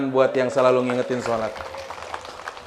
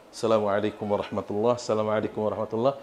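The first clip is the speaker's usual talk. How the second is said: quiet prayer close; 6 semitones lower, 8 dB quieter, faster than usual. Acted. Dying man ends prayer quietly.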